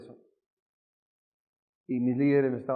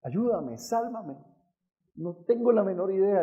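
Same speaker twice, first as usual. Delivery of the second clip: higher-pitched, imploring cry to God; raised-pitch reenacted begging; retold tearful plea; 6 semitones higher than usual. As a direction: plead for rescue as if near tears. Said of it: "yo empecé a llorar".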